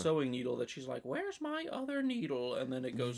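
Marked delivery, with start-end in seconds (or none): 1.1-2.5 put-on squeaky old lady voice; high-pitched mock wife. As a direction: pitch way up as the victim. Wife voiced before killing.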